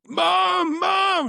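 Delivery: high-pitched shout for mom; excited calling out. Retold or imitated. Son bursting in on his mother, excited shout.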